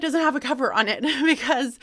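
real laugh bubbling up mid-sentence. Real, not acted. Teacher laughs to viewers about the missing cover, laughing.

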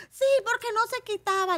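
put-on loud, very high kid retort; shrieky-high mock child protest; defiant little boy. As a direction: imitate a child shouting an excuse. Boy defends his kick in story.

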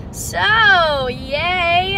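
mock cheerful, loud and high; sarcastic yay. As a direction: fake celebration after bad news. Cheer right after crashing her car.

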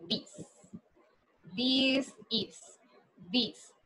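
"This" said softly and clearly as English /dis/; quiet careful "This is" demo; English pronunciation demo. Shown as a demonstration. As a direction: model the word "This" carefully for the class. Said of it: "Voy a decirlo así".

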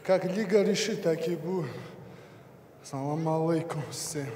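normal talking voice

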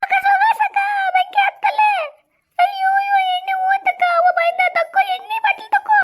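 panicked shout. Acted. Fire breaks out, girl panics.

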